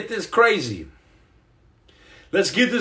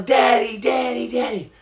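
lightly imitated kids' calls, slightly higher; kids yelling; 4 semitones higher than usual. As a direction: mimic children calling for dad, fading off. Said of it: "These kids are yelling".

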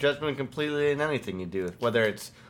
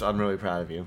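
lower, deadpan mock pride; insincere praise; 4 semitones lower, faster than usual. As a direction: drop the gush and offer dry congratulations. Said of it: "you just sound like a dick".

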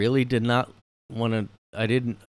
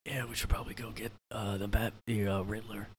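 softer, near-whispered Batman reply; mock Batman mumble; 9 dB quieter than usual. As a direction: play Batman barely audible. Voicing Batman answering Gordon, softly.

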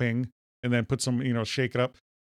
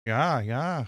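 Minnesota accent. Real, not acted. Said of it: "you do the Minnesota accent and it sticks".

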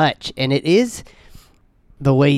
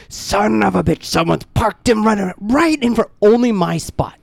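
higher, drawn-out indignant cursing; retold angry outburst; 7 semitones higher than usual. Driver finding a car blocking the parking space, angry outburst. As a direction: play up the outrage at a parking offender.

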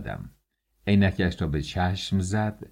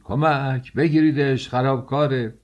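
high, louder alarmed call for help; frightened cry; 7 semitones higher, 3 dB louder than usual. A narrator's reading. Startled boss calls staff for help, frightened cry. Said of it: "خیلی ترسید و فریاد کشید".

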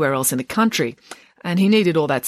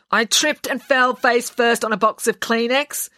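higher dry sarcastic drawl on the excuse; 7 semitones higher than usual. A narrator's reading. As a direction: throw it away with heavy sarcasm. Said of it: "she said sarcastically".

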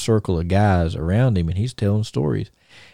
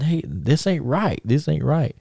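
higher, slow, mild complaint; retold complaint; 7 semitones higher, slower than usual. Dusty to the boot maker, mildly complaining about colours. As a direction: protest slowly that it's wrong.

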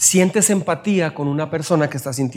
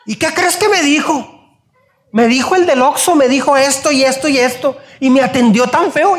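much higher, loud outraged complaint; a kid ranting about the shop clerk; indignant kid; 9 semitones higher, 5 dB louder than usual. Retold or imitated. Child complains to mom.

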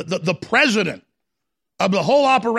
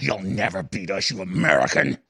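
demon voice; 9 semitones lower than usual. Retold or imitated. Host mimicking a globalist guest on the phone, lightly demonic. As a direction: hiss the threat like a demon, only half put on. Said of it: "He actually talked like a demon".

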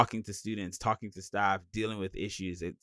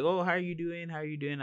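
people asking; 7 semitones higher, 4 dB quieter, faster than usual. Retold or imitated. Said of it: "anytime anyone has asked me, like".

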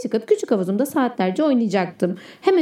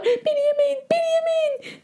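panicked plea; 14 semitones higher than usual. Acted. Boy begging the baby dinosaurs pecking him, high panicked plea. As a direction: beg the chicks not to eat you, high and frightened. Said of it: "diye bağırdım".

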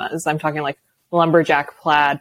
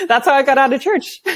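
higher-pitched, laughing punchline; 14 semitones higher than usual. Real, not acted. Co-host ends her church pajama story, laughing. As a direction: land the punchline through real laughter.

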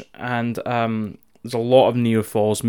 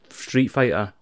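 usual speaking voice

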